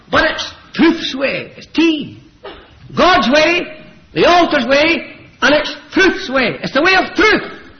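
high, loud, emphatic preaching. Real, not acted. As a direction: hammer each point home. Hammers home the three ways.

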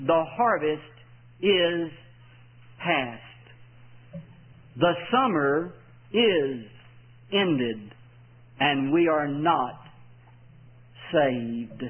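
very slow, low, weighted phrases with long pauses; solemn scripture reading. Real, not acted. Solemn reading of the saddest verse.

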